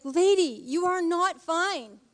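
high, exasperated mock scolding; acted-out plea to a woman in denial; imagined telling-off. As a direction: stretch the key words in playful disbelief. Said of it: "I want to be like".

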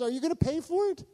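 very high-pitched anxious question; imitating anxious girl. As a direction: mimic her worry with pitch shooting up. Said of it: "She was freaking out".

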